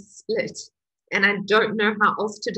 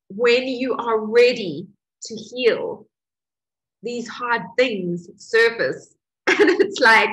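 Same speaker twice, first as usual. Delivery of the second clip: ordinary talk, nothing marked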